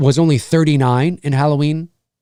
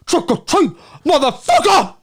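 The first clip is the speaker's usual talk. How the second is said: much higher, over-the-top mimicry; exaggerated impression; 10 semitones higher than usual. Streamer overdoing a film character's line, exaggerated impression. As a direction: push the catchphrase high and hammy. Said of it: "I usually exaggerate and go".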